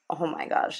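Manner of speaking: normal talking voice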